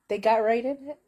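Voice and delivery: ordinary talk, nothing marked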